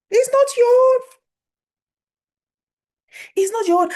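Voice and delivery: high, loud preacher's cry; emphatic rebuke. Real, not acted. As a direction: cry it out as a forceful declaration. Emphatic rebuke to someone owning a headache.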